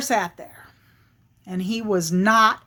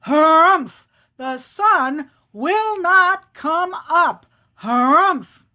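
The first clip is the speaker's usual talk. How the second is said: slow, straining bear chant, pitched up; insistent bear; 5 semitones higher, 4 dB louder, slower than usual. Acted. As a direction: drag out each word and punch the harumph. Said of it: "And the bear says".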